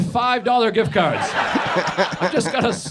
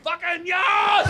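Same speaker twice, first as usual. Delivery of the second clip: high, stretched-out mock cheer of a thrilled kid; hammed-up kid's whoop, drawn out slow; 11 semitones higher, much slower than usual. Kids given money, mock cheering slowly. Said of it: "they're like".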